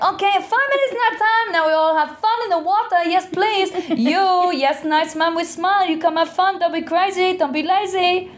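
very high, pushy rep in a bad accent; mock an over-keen entertainer rallying in accented English; accented resort rep. Rep trying to get sunbathers into yoga, high accented cheerleading. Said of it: "trying to rile it up in their second language".

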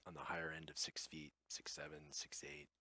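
hushed aside; whispered. A narrator's reading. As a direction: whisper the numbers. Aside on her height.

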